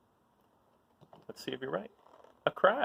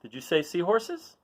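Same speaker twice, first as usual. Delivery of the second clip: ordinary delivery, nothing marked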